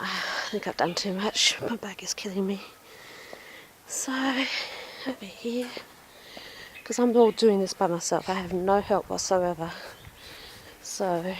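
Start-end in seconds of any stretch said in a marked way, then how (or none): 0.0-5.7 whispered complaint of pain; whispering. Real, not acted. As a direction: whisper it, sore and tired. Aching back after gardening.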